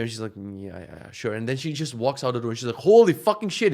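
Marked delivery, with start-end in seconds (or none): 0.3-1.3 low, soft brush-off; dismissive mumble. Retold or imitated. Wife in bed doubting husband's cold warning, soft and dismissive. 2.9-3.7 loud, high-pitched shock; shocked outburst. Retold or imitated. Wife walks out into the cold house, loudly shocked.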